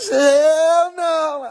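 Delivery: high, loud hell no like mom; mock shocked cry; shocked exclamation. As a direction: yell it in disbelief. Imitating mom's reaction.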